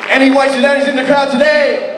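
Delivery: high, loud call to the room; calling out to crowd. Real, not acted. Comic calling out to the audience, loud and high. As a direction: shout it out to the whole crowd.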